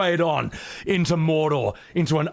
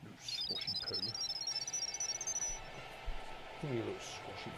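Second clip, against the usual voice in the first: much softer, doting pet talk; cooing at cat; 5 semitones lower, 18 dB quieter than usual. Real, not acted. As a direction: murmur lovingly to the kitten.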